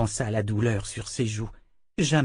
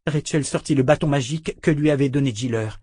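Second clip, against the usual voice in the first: usual speaking voice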